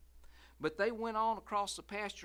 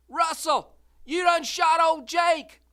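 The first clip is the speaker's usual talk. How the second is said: much higher, louder shriek; screamed; 12 semitones higher, 18 dB louder, slower than usual. Acted. Lucille screaming at Russell who shot the mule. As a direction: scream the news in horror. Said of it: "Lucille screamed".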